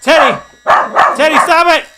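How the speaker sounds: loud, high stern yell; shouting at Teddy to stop; shouting at the dog. Real, not acted. Stopping the dog.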